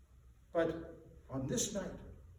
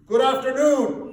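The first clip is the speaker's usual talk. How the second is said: loud, higher snapping farewell; curt dismissal; 7 semitones higher, 13 dB louder than usual. A narrator's reading. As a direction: slam the door with words. Scrooge dismissing nephew curtly.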